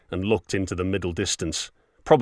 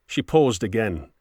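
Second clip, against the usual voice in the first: ordinary delivery, nothing marked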